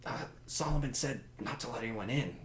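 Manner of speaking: hushed, low aside between guards; guards whispering. Acted. Guard to fellow guard about the odd costumed dancers, whispering low. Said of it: "like, whispering to each other".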